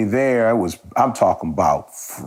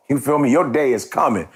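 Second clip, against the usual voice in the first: normal talking voice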